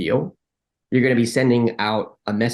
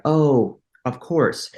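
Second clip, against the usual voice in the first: sudden realization; 5 semitones higher, much slower than usual. Retold or imitated. Arguer twenty minutes later, suddenly seeing sense. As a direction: act out the penny dropping.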